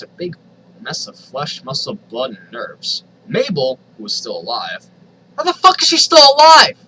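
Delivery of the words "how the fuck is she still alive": high, loud outraged cry; disbelieving yell. Real, not acted. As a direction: shout at the absurdity. Outraged reaction to absurd plot.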